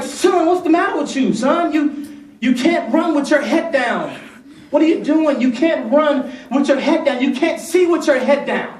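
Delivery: high, exasperated dad calling out to his son; mock-scold a toddler who ran into a wall; a father half-shouting at his son after the fall; retold scolding of son.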